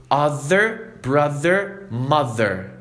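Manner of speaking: brother, mother, other with a buzzing voiced th; voiced th demo. Shown as a demonstration. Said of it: "Mais uma vez".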